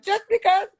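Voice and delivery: high, loud mock protest; joking comeback. Castmate quoting a friend's joke, in high mock protest.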